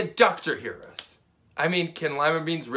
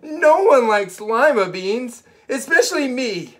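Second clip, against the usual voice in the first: put-on stubborn little girl; 10 semitones higher, 9 dB louder than usual. Camilla denying she likes beans, high and loud. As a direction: insist like a defiant child.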